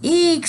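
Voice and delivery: loud high squeal of shock; high girlish shriek. A narrator's reading. Girl seeing a bald Santa, high shriek. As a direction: shriek like a startled little girl. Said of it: "some girl shouted".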